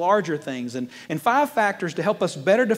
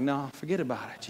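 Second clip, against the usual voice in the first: low, softer retreat; mock backing down; 4 semitones lower, 7 dB quieter than usual. Husband backs down from his wife, meek mock retreat. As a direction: drop the voice and back off, half-joking.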